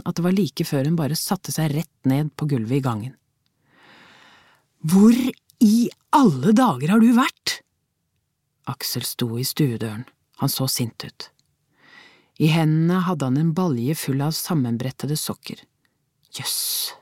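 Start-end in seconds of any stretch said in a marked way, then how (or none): 4.8-7.6 loud, slow, high angry question; husband snapping at wife come home late, angry; angry demand. A narrator's reading. 16.3-16.9 whispered thought. A narrator's reading. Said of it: "tenkte hun".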